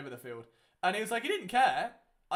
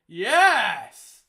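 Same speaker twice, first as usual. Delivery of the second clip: loud triumphant yell; excited shout; 3 semitones higher, 14 dB louder than usual. Real, not acted. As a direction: cheer the big play much louder. Big play while watching the game.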